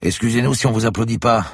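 loud, sarcastic jab; spat out. Acted. Inspector mocking the professor, spitting the words. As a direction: spit the sarcasm at him. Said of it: "cracha un inspecteur virulent".

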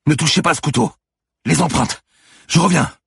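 high, loud parting commands; hurried, high-pitched yelling; urgent shouted orders. A narrator's reading. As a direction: shout instructions as you rush out the door. Shouting orders while rushing out.

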